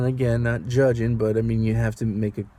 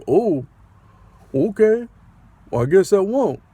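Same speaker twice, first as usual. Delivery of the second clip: mock sulky reply; 8 semitones higher, 6 dB louder than usual. Voicing sulky passenger backing down.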